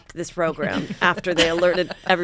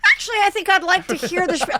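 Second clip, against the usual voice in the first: high, mock-innocent husband voice; imitating Peter; 11 semitones higher than usual. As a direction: play him pleased with himself, pitched way up. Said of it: "And Peter goes".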